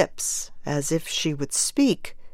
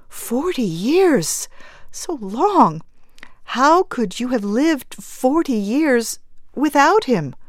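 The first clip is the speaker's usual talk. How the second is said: stunned grief; 7 semitones higher than usual. Acted. Mother, shocked and grieving.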